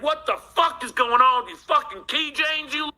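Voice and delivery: retold furious outburst, pitched well up; angry rant. Caller retelling his phone rant about the keychains, furious. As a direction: fire it back at the caller, fuming. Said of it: "And I was like".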